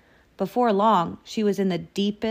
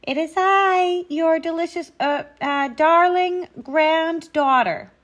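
loud falsetto disguise; high voice; 9 semitones higher, 7 dB louder than usual. A narrator's reading. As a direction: pose sweetly as the granddaughter, fumbling midway. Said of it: "said the wolf in a high voice".

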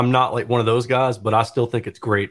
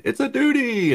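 squeaky, much higher "It's a duty"; high mock cry; 13 semitones higher than usual. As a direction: shriek it like the panicked swimmer. Caddyshack scene quote.

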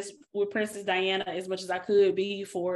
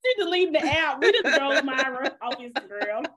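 real laughter through the words, louder and higher; laughing while talking; 4 semitones higher, 5 dB louder than usual. Real, not acted. Host teasing co-host over deleting Instagram, laughing. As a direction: tease through a loud, high laugh.

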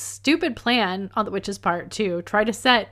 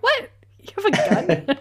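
surprised; 10 semitones higher, faster than usual. Retold or imitated. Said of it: "I was just like".

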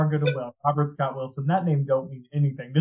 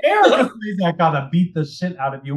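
ordinary talk

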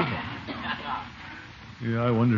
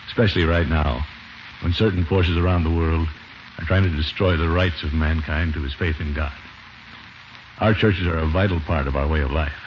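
lower, earnest public-service address; sincere closing appeal; 8 semitones lower than usual. Acted. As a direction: speak from the heart to listeners.

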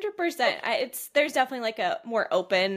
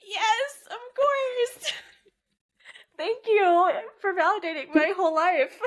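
high, drawn-out yes breaking into laughter; amused gushing; 8 semitones higher, 3 dB louder, slower than usual. Real, not acted. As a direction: gush thanks through a laugh. Gushing, amused thanks to co-host.

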